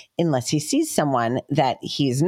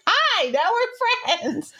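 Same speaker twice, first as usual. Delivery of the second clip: very high, drawn-out dog greeting; friendly dog voice; much slower than usual. Retold or imitated. Owner voicing the dog to a new friend, high slow doggy voice. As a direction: stretch it out high and chummy, as the dog.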